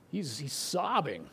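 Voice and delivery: usual speaking voice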